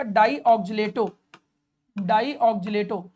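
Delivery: drawn-out, very slow dictating pace; very slow, each syllable stretched for note-taking; slow dictation. Shown as a demonstration. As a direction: spell it out slowly for the class to write. Dictating the ligand name slowly.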